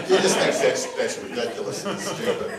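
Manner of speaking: ordinary talk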